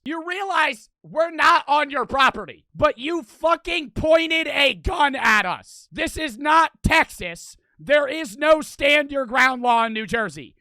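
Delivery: loud, high, indignant tirade; screaming at someone. Retold or imitated. Teller screaming at the gunman's house, angry tirade. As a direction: yell each point toward a distant house. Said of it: "I'm screaming this to his house".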